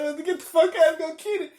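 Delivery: very high, loud, breaking into laughter; laughing retold order. Driver retelling the order to get out, laughing and high-pitched. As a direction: repeat the order through laughter.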